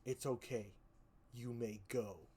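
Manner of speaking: a hint of a cold, deadpan killer; lightly voiced killer. Killer calmly telling the little girl to go, lightly low and soft. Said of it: "he just says".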